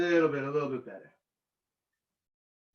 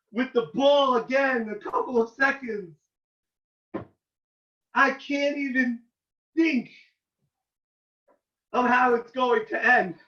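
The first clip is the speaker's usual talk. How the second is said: usual speaking voice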